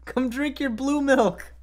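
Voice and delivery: high, slow calling voice; Aunt Beru. Acted. Aunt calling Luke to his milk, high drawn-out call. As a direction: call out slowly in a high-pitched voice.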